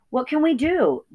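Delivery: high, frightened little pig; frightened piglet. Acted. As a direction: plead in fear. Babe frightened.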